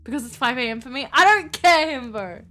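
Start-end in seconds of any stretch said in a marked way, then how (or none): 1.1-2.4 loud, high burst of protest; playful outburst. Real, not acted. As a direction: shout it over a teasing friend.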